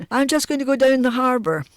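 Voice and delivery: a hint of an innocent act; retold casual excuse. Father's excuse, retold with put-on innocence.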